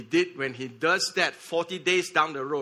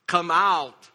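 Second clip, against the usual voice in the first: slow, raised calling-out; loud cry; 2 semitones higher, 6 dB louder, slower than usual. Acted. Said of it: "He cried out in a loud voice".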